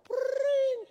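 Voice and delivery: high, trilled ringing noise; piercing rolled "brrring"; imitated phone ring. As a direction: mimic a shrill telephone ring.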